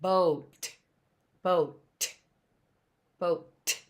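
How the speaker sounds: "Boat" with a crisp, separate T at the end; final T sounded. Shown as a demonstration. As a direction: say "boat" and let the T pop after a tiny gap. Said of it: "What do we hear at the end?".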